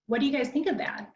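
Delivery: a quick upbeat question to the audience, with a steep rise on "that?"; rising intonation. Shown as a demonstration. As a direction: question tune rising high at the end, pitch well up. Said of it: "so like a rising intonation would be".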